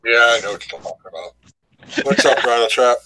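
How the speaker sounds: ordinary delivery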